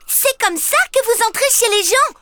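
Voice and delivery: put-on high, fast indignant little girl scolding. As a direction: tell them off properly. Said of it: "Indignée, elle leur crie". (petite fille indignée qui gronde, voix aiguë)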